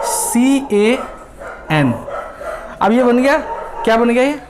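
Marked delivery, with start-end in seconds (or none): 0.0-1.9 CAN spelled out letter by letter, very slowly; slow spelling. Shown as a demonstration. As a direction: drag each letter of CAN out for the class. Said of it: "spelling a word out".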